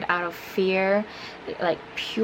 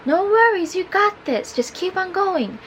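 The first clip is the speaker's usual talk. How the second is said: mock call of encouragement in a raised pitch; imitated encouraging yell; 8 semitones higher than usual. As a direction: coach someone on in a high, light voice. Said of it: "yelling up at me".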